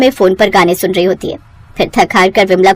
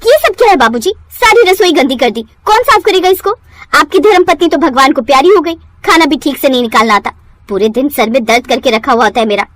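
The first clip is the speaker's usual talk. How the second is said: angry scolding; 4 semitones higher than usual. Acted. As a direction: yell at the old man for the mess. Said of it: "चिल्लाती हुई आती है". (गुस्से में चिल्लाकर डांटना)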